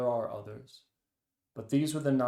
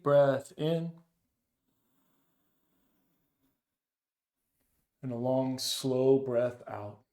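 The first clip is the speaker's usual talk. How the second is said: slow, calm breath-guiding voice, long pauses between cues; soothing meditation leader, slow and spacious; guided meditation; 5 dB louder than usual. Acted. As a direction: ease them into the breath, unhurried. Leads a meditation.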